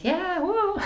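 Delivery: very high, drawn-out whoop; retold tipsy cheer. Traveler offered liquor on a night train, retold whooping cheer.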